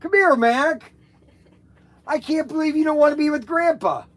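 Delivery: coaxing. Real, not acted. Coaxing grandchild to come over.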